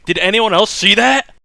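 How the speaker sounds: freaked-out, high, loud outcry; loud exclamation. Acted. Shaken rider exclaims to companions after a vision, loudly. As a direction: blurt it out loudly, dead serious. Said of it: "loudly exclaim".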